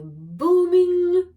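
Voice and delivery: loud, high booming voice. A narrator's reading. Storyteller describing a boy reading aloud, booming. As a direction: let the word boom out, loud and high.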